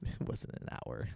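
whispered, slow denial; voiceless, slow protest; muttered. Real, not acted. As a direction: mutter it under your breath.

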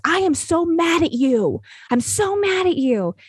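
high, slow mock-angry child voice; imitating a furious eight-year-old; angry little girl. Dancer telling her teacher off, high mock-angry child voice. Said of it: "she got in my face and she goes".

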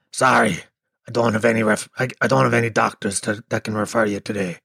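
smoke-wrecked receptionist turning a caller away; chain-smoker receptionist. Retold or imitated. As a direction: imitate a croaky heavy smoker on the phone.

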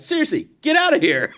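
high, comic retold outburst; mock exasperation. Retold final order to teens.

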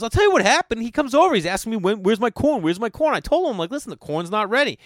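high-pitched acted-out complaint; hammed-up exasperation; exasperated rant. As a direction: rant like a fed-up cook pestered once too often. Acting out friend's exasperated complaint.

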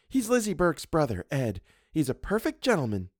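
lightly voiced girlish chatter; chattering young model. A narrator's reading. Model reassuring the painter about her admirer, chattering. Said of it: "she began to chatter".

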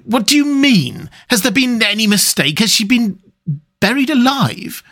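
high, distraught questioning; anguished. A narrator's reading. Lover asking if she was buried alive, anguished. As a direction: plead for an answer, pitch right up. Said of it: "He groaned in anguish".

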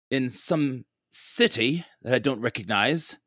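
a confused traveller asking for help; character speaking aloud. Acted. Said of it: "I'm just gonna say".

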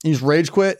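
ordinary talk

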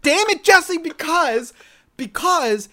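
loud, high, exasperated. Real, not acted. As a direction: burst out in mock frustration. Exasperated at teasing.

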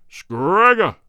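roaring a name. Acted. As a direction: bellow for his son. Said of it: "roared the king".

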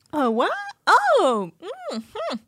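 high, fake-surprised innocent act; mock innocent Kate. Imitating Kate's fake surprise, high innocent voice. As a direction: play dumb, high and wide-eyed. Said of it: "Kate does her like".

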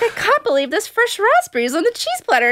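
high, wide-eyed mock amazement. Contestant marveling at raspberries, high and gushing. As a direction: gush over the berries, imitating her. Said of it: "She's like".

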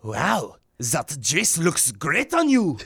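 put-on slow, deliberate French accent; put-on French accent, taken slowly. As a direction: draw it out in a mock French accent. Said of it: "In a French accent".

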